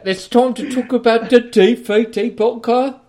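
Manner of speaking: loud, high cartoon British accent; over-the-top British greeting, high and loud. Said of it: "we do British better than you".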